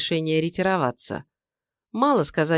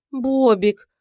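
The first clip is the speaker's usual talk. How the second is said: high, loud put-on gasp of a name; fake gasp; 7 semitones higher, 5 dB louder than usual. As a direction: gasp his pet name falsely. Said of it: "фальшиво ахнуло неземное создание".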